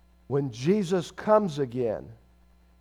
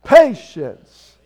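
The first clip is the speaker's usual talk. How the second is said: PATIENCE louder, punched and drawn out; with gusto; 2 semitones higher, 13 dB louder than usual. Shown as a demonstration. Preacher leading the congregation in saying PATIENCE together, with gusto. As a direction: belt out PATIENCE with more force. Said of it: "with a little more gusto".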